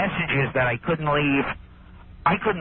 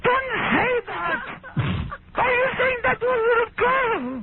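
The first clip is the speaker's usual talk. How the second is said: really upset housekeeper. Retold or imitated. Comedian mimics housekeeper scolding him over the girl, shrill upset wail. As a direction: imitate a housekeeper shrieking in outraged distress. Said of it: "Barbara gets really upset".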